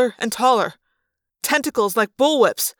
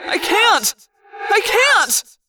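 cried; 10 semitones higher, much slower than usual. A narrator's reading. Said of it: "I cried".

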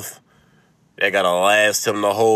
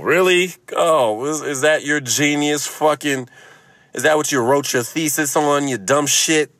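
higher, louder sarcastic scorn; mock outrage; 8 semitones higher, 4 dB louder than usual. Comic mocking the doctor's advice, higher and louder. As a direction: sneer at the doctor's genius.

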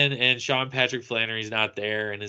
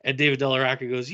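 normal talking voice

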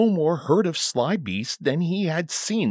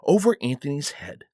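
usual speaking voice

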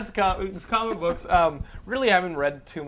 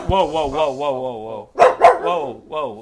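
excited whoa; 6 semitones higher, 9 dB louder, slower than usual. Real, not acted. Dogs wake up and appear.

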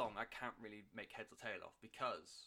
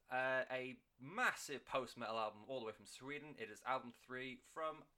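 ordinary talk

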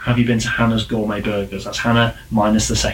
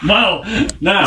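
high, louder mock-shocked well now; 8 semitones higher, 5 dB louder than usual. Real, not acted. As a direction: act scandalised but amused. Reacting to F-bomb suggestion.

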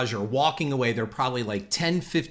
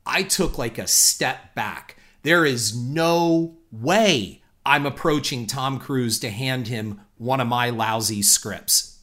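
ordinary talk, nothing marked

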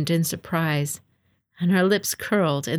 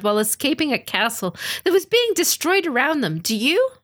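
put-on higher, firm voice of a young woman defending her lord; young samurai woman pleading; 6 semitones higher than usual. A narrator's reading. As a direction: speak up boldly for Matsu. Tsunuri defends Lady Matsu.

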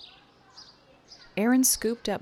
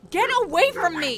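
screamed high and loud, pushing her away; a raw, high-pitched scream of get away; furious shout; 10 semitones higher, 6 dB louder than usual. Acted. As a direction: yell it to make them back off. Angry outburst at Erin.

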